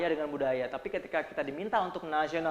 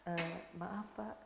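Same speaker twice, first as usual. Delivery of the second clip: slow, soft, halting warning; too slow; 14 dB quieter, much slower than usual. Shown as a demonstration. Slow speaker telling the boss his house burns, dragging. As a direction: drag it out, no urgency at all. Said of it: "ngomongnya kelambatan".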